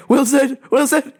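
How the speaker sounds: hammed-up shout across an empty island; mock desperate calling for a lost friend; Castaway calling out. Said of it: "treating Gus like Castaway".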